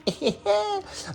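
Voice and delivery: high-pitched chuckle; brief high giggle between sentences; short laugh. Real, not acted. Laughs after naming submarine film.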